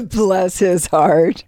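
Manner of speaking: slow words through laughter; laughing. Real, not acted. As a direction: laugh while saying it slowly. Laughing after joke.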